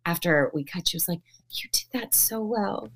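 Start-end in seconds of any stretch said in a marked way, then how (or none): 1.5-2.9 hushed, slow and heartfelt; tender praise. Retold or imitated. Writer to actress right after cut, tender hushed praise. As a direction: praise gently in a soft, lingering voice.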